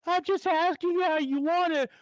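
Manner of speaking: mock her in a high, strained voice; drive-thru girl. Drive-thru girl answering shouting customer, high mock voice. Retelling worker's reply to shouting.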